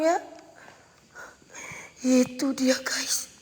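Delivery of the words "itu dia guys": hushed breathy whisper to viewers. Real, not acted. Ghost hunter whispering to viewers at a haunted spot, whisper. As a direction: whisper it so nothing hears you.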